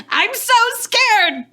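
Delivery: mild yell, leaping high and drawn out; yelling between shots. Acted. Dawn admits fear to Ash mid-fight, yelling. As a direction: start level, then cry out high and slow. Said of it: "yelling between shots".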